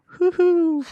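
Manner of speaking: very high yelping hoo hoo; high squeaky hoot. Retold or imitated. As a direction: squeak out a hoot as your stomach gets poked. Poked hard in stomach.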